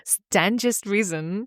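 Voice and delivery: "Stangest" dicho lento, sin la r; typo reading. Shown as a demonstration. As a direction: lee "Stangest reason" tal cual, despacio y divertida. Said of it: "I wrote stangest instead of strangest".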